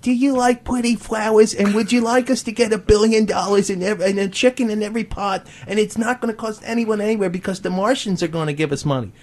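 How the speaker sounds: high, naive sing-song questions; mock referendum wording. Host lampooning the ballot wording for the guest, high and sing-song. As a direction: read it like a silly sweet ballot question, pitched high. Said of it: "referendum was like".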